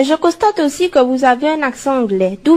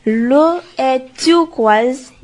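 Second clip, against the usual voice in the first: slow dreamy; much slower than usual. Acted. Reporter dreamily describes Hawaii beach.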